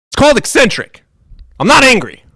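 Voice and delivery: ironic shout. Real, not acted. Streamer yelling at chat that she is merely eccentric. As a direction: bellow your denial, proving the point. Insisting loudly she isn't angry.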